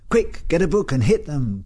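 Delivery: high, urgent cry. A narrator's reading. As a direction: call for a book in a high, hurried alarm. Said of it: "cried Leslie".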